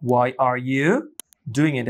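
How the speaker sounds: normal talking voice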